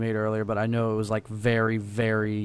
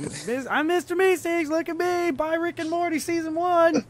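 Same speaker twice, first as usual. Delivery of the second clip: Mister Meeseeks. Retold or imitated. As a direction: pitch it way up, like an excitable cartoon pitchman. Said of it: "walking around yelling".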